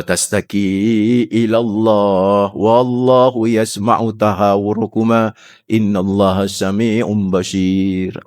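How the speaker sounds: slow, chanted Arabic verse; Quran recitation. Acted. Preacher recites Al-Mujadilah to the congregation, slow and chanted. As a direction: recite the verse slowly and devoutly.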